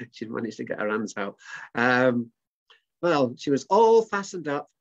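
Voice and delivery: ordinary delivery, nothing marked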